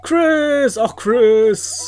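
loud, high call of the name. Acted. Host greets his Mii Chris with a loud, happy call. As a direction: hail him like an old friend across the room.

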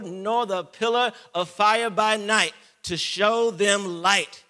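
high, loud, slow proclamation; preaching at full force. Acted. Preacher proclaiming mercy to the church at full force. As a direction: ring out God's mercy.

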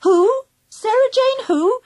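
high, baffled questioning; mystified woman on the line; puzzled woman. A narrator's reading. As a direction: ask in high bewilderment. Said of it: "a puzzled woman's voice saying".

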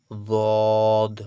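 বদ very loud with a long rounded vowel; rounded, drawn-out. Shown as a demonstration. Teacher models for students, বদ rounded and stretched. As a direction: round the mouth and hold বদ. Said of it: "মুখগুলো কিন্তু গোল হয়ে যাবে".

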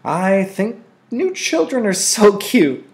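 put-on high, slow, dreamy little-girl voice; dreamy sigh. A narrator's reading. Lily gushes over the new child, sighing dreamily. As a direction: sigh it out, gushing over the baby. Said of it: "Lily sighed".

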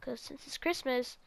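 normal talking voice

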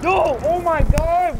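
loud furious shout at the screen; raging yell. Real, not acted. Gamer losing it at the screen, furious yell. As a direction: scream it out in real gamer rage.

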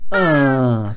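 loud grumbling groan; bad-mood groan for children to copy; demonstrated groan. Shown as a demonstration. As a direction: let out a grumpy "uh". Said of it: "every time I say the word groaned".

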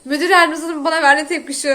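ordinary talk, nothing marked

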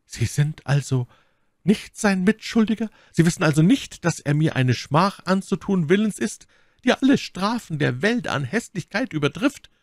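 high, impassioned disbelief; earnest Milady. A narrator's reading. Milady asks Felton if he is no accomplice, earnestly appalled. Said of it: "mit einem unglaublichen Ausdruck von Wahrheit".

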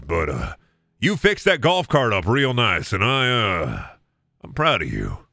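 hesitate, then admit pride awkwardly; proud dad. Acted. Dad praises son's golf cart repair, halting low warmth. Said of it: "imitate my dad, but proud of me".

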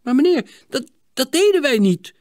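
high, loud, stammered denial; protesting. Acted. Boys to wrongly accusing neighbour, protesting. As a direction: protest your innocence. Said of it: "protesteerden de jongens".

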